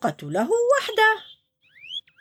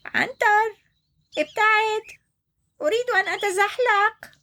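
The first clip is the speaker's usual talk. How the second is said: high, small turtle voice; little turtle; 12 semitones higher than usual. Acted. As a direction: ask the hippo to move. Little turtle asks hippo to move. (السلحفاة تطلب من عنتر الابتعاد)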